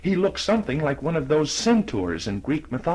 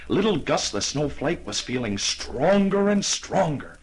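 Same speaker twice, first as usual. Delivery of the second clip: usual speaking voice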